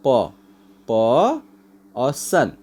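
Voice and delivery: प held alone as "puh", pause, then सन as "sun"; separate syllables. Shown as a demonstration. Teacher counting out a word's two syllables, each said separately. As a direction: isolate प and सन with pauses between. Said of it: "इसमें दो syllable है".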